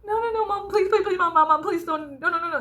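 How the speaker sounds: put-on high, pleading little-girl voice; begging child. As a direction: beg mom like a mortified kid. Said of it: "me begging my mom not to embarrass me".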